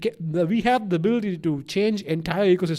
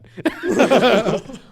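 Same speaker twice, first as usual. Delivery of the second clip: higher, louder burst of laughter; laughing; 6 semitones higher, 7 dB louder than usual. Real, not acted. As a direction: crack up at your own absurd plan. Laughs about killing whales.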